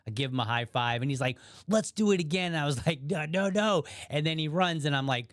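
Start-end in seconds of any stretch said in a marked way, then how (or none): 1.7-2.5 higher-pitched imitation of an excited boy; retold childlike eagerness; eager child. Boy begging for another race. 3.0-3.8 higher-pitched pleading refusal; retold comic dismay; mock protest. Runner protesting another race.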